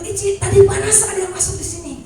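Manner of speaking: put-on a child bursting with news; lightly voiced thrilled girl; excited cousin. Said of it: "dia bilang".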